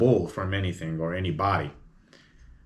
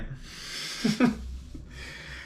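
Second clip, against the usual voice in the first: airy laugh; 14 semitones higher, 6 dB quieter than usual. Real, not acted. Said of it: "laughs at own dumb question".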